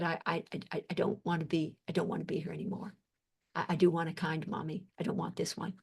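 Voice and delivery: low and very soft, hushed with feeling; retold child's plea. Analyst retelling an adopted girl's plea to her doctor, hushed. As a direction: quote the little girl softly, sinking low.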